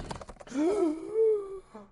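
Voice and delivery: long high dramatic gasp; shocked nerd gasp. Acted. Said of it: "that nerd voice".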